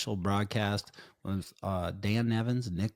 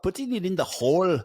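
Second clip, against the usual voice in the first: higher, louder mock Scottish burr; Scottish accent; 9 semitones higher, 6 dB louder than usual. Podcaster joking about putting in the hole, Scottish accent. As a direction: put on a little Scottish accent. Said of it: "That was a little Scottish".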